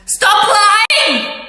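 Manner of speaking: sudden high, angry yell; angry shout. Retold or imitated. Speaker confronts person who keeps denying it, angry shout. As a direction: cut them off with a shouted order. Acted quarrel, ordering him to stop.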